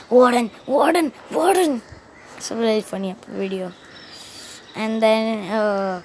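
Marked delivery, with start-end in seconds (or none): 0.1-1.8 insistent calling. Retold or imitated. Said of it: "He actually said it three times".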